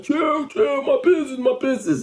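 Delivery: imitating Tony begging them to stop; panicked shop owner. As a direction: plead like he's protecting his store. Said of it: "Remember Tony, like".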